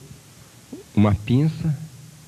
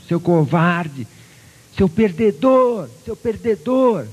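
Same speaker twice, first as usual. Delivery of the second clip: echo taunting; 6 semitones higher, much slower than usual. Acted. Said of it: "o eco".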